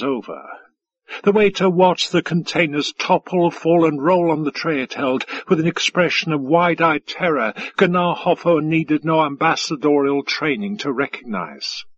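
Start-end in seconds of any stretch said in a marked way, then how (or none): none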